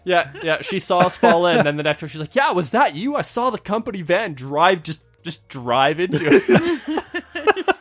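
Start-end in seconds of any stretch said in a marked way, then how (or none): 2.3-7.8 lightly voiced coworker, much higher; a hint of a gleeful coworker, laughing at the end; amused coworker. Coworker quoted again, amused.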